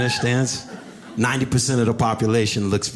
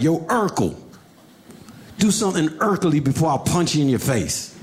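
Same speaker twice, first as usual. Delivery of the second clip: put-on higher, tough kid threat; lightly voiced older kid; 6 semitones higher than usual. Older bully threatening him in class, lightly voiced higher threat. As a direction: lean on the kid with a mild sneer. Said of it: "This older kid was like".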